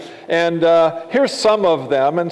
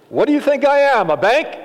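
mock exasperated dad; 9 semitones higher, 3 dB louder than usual.

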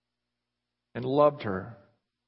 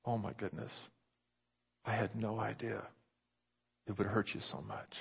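softer, broken-hearted confession; remorseful, hushed; 3 semitones lower, 10 dB quieter than usual. Acted. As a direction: realize the pain you caused. Husband's sudden remorse.

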